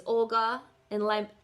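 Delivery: ordinary delivery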